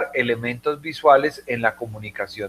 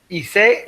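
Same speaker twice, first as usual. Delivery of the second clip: Hice said as i-SÉ, from izar; stress on last syllable; 7 semitones higher than usual. Shown as a demonstration. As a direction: land hard on the end of Hice: i-SÉ. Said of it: "es de izar bandera".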